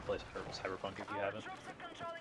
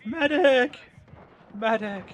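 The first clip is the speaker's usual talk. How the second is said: high, loud cry for a medic; man down shout; 13 semitones higher, 8 dB louder, slower than usual. Real, not acted. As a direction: shout for help across the field. Calling for medic.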